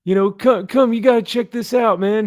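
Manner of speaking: hammed-up passerby; mock New York bro. Retelling the comic, bystander calls to Vinny in a mock bro voice. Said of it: "it's like".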